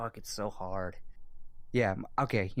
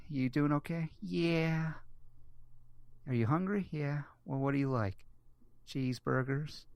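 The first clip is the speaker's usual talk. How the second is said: put-on child and Happy dialogue; 5 semitones higher than usual. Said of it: "it's like".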